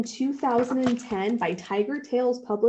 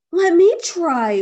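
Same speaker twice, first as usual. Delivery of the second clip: high, loud eager whoop; 8 semitones higher, 9 dB louder than usual. A narrator's reading. Bear eager to climb the owl's tree, whooping. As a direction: burst out with excitement. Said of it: "whooped Douglas".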